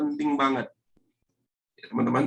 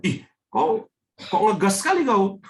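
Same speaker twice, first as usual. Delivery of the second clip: irritated complaint; 6 semitones higher than usual. Retold or imitated. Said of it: "Banyak orang berantem hanya gara-gara suaranya".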